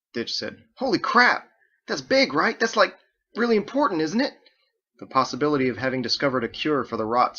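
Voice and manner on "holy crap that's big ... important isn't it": high, loud, eager exclamation; excited outburst. Acted. Ditch reacts to the cure discovery, high and thrilled. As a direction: burst out with excitement at the news.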